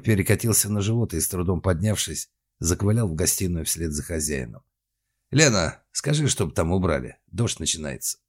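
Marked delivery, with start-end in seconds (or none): none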